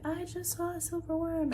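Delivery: high-pitched mock announcement, held up high; imitating Martin. Martin running up to report a worm, high put-on voice. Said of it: "Martin came up and was like".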